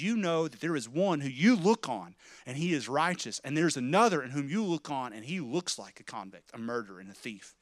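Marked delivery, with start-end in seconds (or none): none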